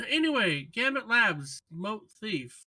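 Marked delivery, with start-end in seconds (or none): none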